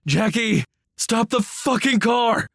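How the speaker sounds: high, loud panicked scream from the passenger seat; screaming bloody murder. A narrator's reading. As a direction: scream it like the crash is coming. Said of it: "screamed bloody murder at me".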